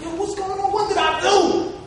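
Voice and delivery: high, worried voice of his younger self; alarmed. Retold or imitated. Captain answering teammate, high anxious tone. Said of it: "I'm like".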